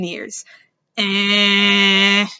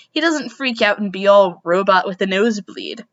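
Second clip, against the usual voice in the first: plain reading voice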